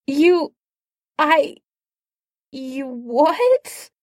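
high, halting, shocked stammer; stunned stammer. Acted. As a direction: stammer in disbelief.

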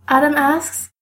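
ordinary delivery, nothing marked

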